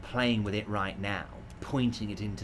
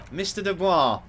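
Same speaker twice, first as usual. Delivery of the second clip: louder, higher call of the name; firm raised call; 6 semitones higher, 6 dB louder than usual. Acted. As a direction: call out to get his attention. Trying to snap Harry out.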